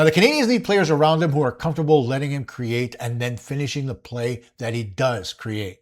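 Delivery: ordinary delivery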